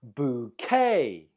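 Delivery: "Bouquet" with stress on the final -quet; second-syllable stress. Shown as a demonstration. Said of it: "The stress is on the second syllable".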